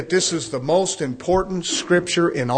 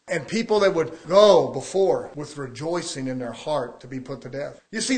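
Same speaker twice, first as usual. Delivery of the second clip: ordinary delivery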